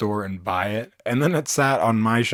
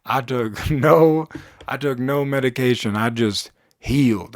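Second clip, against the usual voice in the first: ordinary talk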